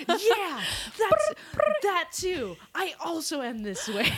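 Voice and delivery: high, hammed-up enthusiasm; hype woman. Acting out how she backs up her friend, hyped-up agreement. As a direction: eagerly agree with everything, voice soaring. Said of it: "You're my hype woman".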